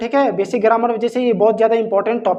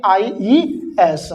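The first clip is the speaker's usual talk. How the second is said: slow spelled answer; 2 semitones lower, much slower than usual. Shown as a demonstration. Stretched answer to own question.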